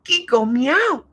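put-on high, slow toddler voice; a toddler's high piping call; little girl voice. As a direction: pipe it up like a tiny child. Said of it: "piped a little voice".

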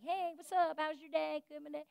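soft casual friendly chat. Shown as a demonstration. Preacher modeling casual prayer to God, soft and casual.

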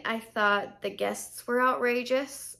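ordinary delivery

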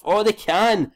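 elated high cry of joy; delighted high-pitched cheer; excited. Real, not acted. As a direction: burst out celebrating the win. Team wins the tie.

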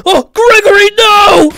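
high, loud wail; mock-distressed scream. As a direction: cry out in hammed-up horror. Mock panic in game.

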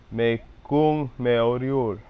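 Me kung me oriol said slowly; slow careful phrase. Shown as a demonstration. Learner practising after the recording, slow. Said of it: "that L at the end".

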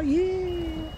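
high, bright little cheer of joy; a squeal of delight at good news. Real, not acted. Cheering to viewers about her business getting registered.

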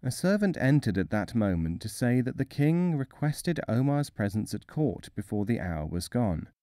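plain reading voice

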